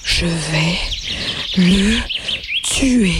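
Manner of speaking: low, gasping threat through lost breath; a dying rabbit wheezing a threat; panting. Acted. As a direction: force each word out between pants. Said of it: "une voix haletante s'éleva".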